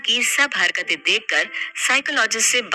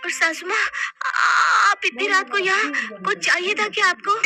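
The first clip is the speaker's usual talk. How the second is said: higher, puzzled; innocent confusion; 6 semitones higher than usual. Acted. Mona waking up before husband and mother-in-law, puzzled. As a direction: wake up bewildered.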